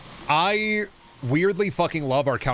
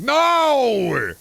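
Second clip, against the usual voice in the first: high, loud, drawn-out cry of no; hammed-up anguished no; mock despair shout; 12 semitones higher, 7 dB louder than usual. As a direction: wail as if doom arrived. Dreading fiddle noise.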